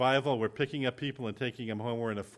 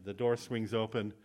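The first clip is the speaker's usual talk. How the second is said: ordinary talk, nothing marked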